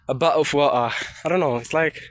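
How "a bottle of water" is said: put-on British pronunciation of 'a bottle of water'; British accent. Shown as a demonstration. Vlogger contrasting it with American English, British accent. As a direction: demonstrate British 'a bottle of water', t's dropped. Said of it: "But in British accent, we say".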